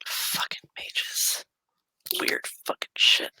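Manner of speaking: slow, voiceless aside in disbelief; whispered grumble. Acted. Elliot grumbles to himself about weird mages, slow whisper. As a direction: whisper it under your breath, weirded out.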